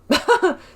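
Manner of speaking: high, loud real laugh; burst of laughter. Real, not acted. Laughing at his own joke about the companions, a high loud real laugh. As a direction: let a sudden laugh burst out.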